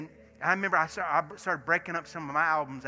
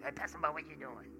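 Brian's voice; 6 dB quieter, faster than usual. Retold or imitated. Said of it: "He talks like that".

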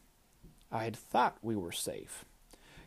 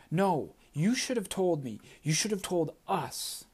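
higher, heated accusation; angry reproach; 6 semitones higher than usual. A narrator's reading. Ayanna scolding Tristan for keeping secrets, heated. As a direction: throw the blame right at him.

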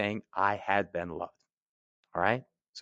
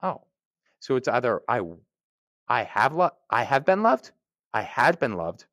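normal talking voice

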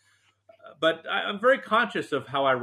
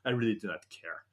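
put-on soft, matter-of-fact announcement in a light French accent; mock French wife; 2 semitones lower, 4 dB quieter than usual. Husband imitating his wife announcing her move to the States, soft French accent. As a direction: shrug it off with a hint of French. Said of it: "And she said".

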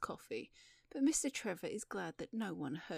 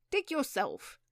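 loud, higher snap back; indignant raised voice; defiant retort; 5 semitones higher, 6 dB louder, slower than usual. Acted. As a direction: throw the order straight back. Man defies the digging order.

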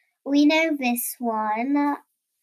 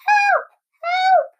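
high-pitched loud yelp; crying for help; 6 dB louder than usual. A narrator's reading. Frightened Rabbit calls out for help, high loud cry. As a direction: squeal it out as a panicked rabbit. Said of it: "cried Rabbit".

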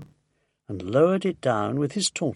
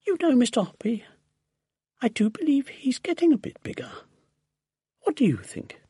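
higher-pitched chatty lady, lightly played; musing aloud to a neighbour, pitched up; lightly voiced lady neighbour; 9 semitones higher than usual. A narrator's reading. Mrs Silver speaking to neighbour.

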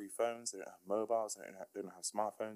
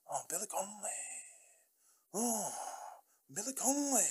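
naff Billy Connolly; 13 semitones higher than usual. Acted. Vlogger demonstrating his rubbish Scottish accent, hammed-up high Billy Connolly. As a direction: put on a rubbish Scottish accent, stretching the vowels. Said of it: "a really naff Billy Connolly".

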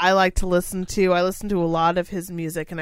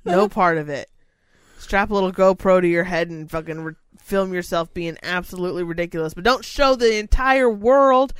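ordinary talk

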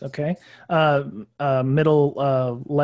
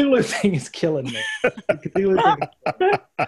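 laughing; 5 semitones higher than usual. Real, not acted. Laughing at the Cthulhu helmet.

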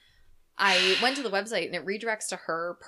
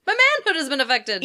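higher, louder hammed-up outcry; mock alarm; 5 semitones higher, 9 dB louder than usual. Playing the victim in co-host's story, mock alarm. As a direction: wail it as the stricken victim, played for laughs.

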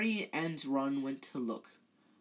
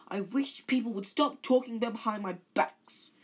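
high, loud tearful wail; choked with tears; 7 semitones higher, 6 dB louder than usual. A narrator's reading. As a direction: voice an upset, tearful ghost. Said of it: "in a choked voice with tears".

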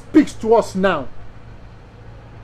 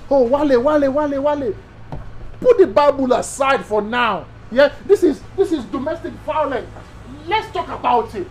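higher, pleading friend's voice; a light take on the deacon coaxing his old friend; imitating the deacon; 5 semitones higher than usual. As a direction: pastor mimicking his childhood friend's plea, higher and eager. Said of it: "He said it in my dialect".

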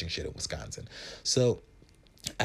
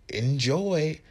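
sing-song host; 7 semitones higher, 5 dB louder than usual. Retold or imitated. Hosts serving food.

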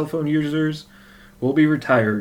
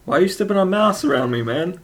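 higher, fast mock complaint; mock scolding; 7 semitones higher than usual. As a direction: play-act an annoyed buddy. Mock scolding about moss.

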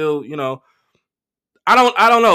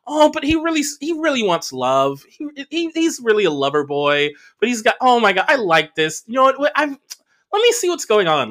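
put-on lightly raised, gushing girly voice; mock smitten woman; 9 semitones higher than usual. Imitating a woman reading his profile, mock smitten. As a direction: imitate a woman falling for the bad boy.

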